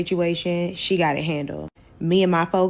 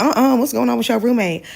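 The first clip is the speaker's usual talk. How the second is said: surprised; 6 semitones higher, 7 dB louder than usual. Retold or imitated. Girls react to roommate screaming.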